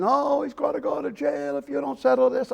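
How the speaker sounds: tearful mother voice, mocking falsetto wail; imitating a crying wife. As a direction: mimic the wife sobbing her plea. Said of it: "the wife's crying".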